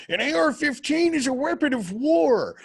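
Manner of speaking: high, mocking squeal of a preachy activist; high mock voice parroting a gun control veteran; mocking whiny voice. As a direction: lampoon the slogan in a high, put-on voice.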